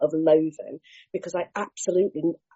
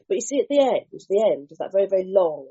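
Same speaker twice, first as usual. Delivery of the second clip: usual speaking voice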